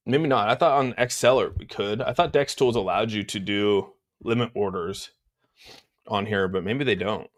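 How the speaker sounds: normal talking voice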